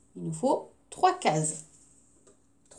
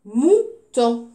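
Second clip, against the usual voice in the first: Mouton loud, MOU, gap, TON; syllable by syllable; 2 semitones higher, 6 dB louder than usual. Shown as a demonstration. Teacher giving the last word, Mouton split syllable by syllable. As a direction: say Mouton as two strong, separate beats. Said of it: "dernière syllabe".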